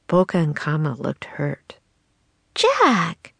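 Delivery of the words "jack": high, falling scolding call; reproachful. A narrator's reading. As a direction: chide him with his name. Annie scolds Jack for being mean.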